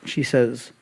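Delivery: ordinary talk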